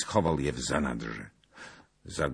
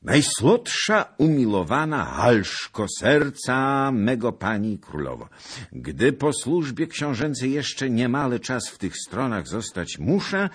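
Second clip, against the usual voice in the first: raised, loud lover's letter voice; tender letter reading; 7 semitones higher, 7 dB louder than usual. Acted. Zagloba reading the love letter aloud, ardent and loud.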